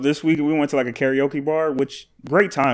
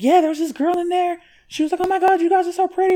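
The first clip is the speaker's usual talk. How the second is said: very high-pitched mock girly chatter; squeaky put-on girlfriend voice; gushing girls. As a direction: imitate women gushing over a new friend. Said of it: "They were talking about, like".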